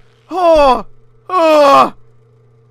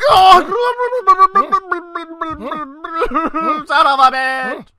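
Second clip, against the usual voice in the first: laughing curse; 2 semitones higher than usual. Real, not acted. Gamer laughing and cursing at the failure.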